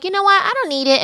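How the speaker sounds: high, breezy dismissive tone; mock flippant Carrie. Carrie walking out of therapy, mockingly breezy. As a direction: wave it off like she's fine. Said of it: "was like".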